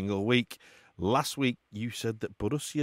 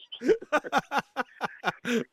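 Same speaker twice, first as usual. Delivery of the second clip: long, high burst of laughter; high delighted laugh; 14 semitones higher than usual. Real, not acted. Host tickled by guest's accent, high laugh. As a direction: laugh helplessly, pitched high.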